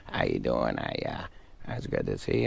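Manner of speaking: low mumbled Sandler impression, lightly done; mumbling Adam Sandler. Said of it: "he just kinda mumbles".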